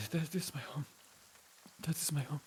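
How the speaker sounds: traumatized survivor pointing out home; shaken, frightened man. Acted. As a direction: say it weakly, still shivering. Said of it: "he's shivering and shaking".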